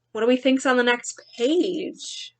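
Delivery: put-on lively read-aloud for children; story narration. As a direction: read it like a picture book to kids. Teacher reads picture book aloud.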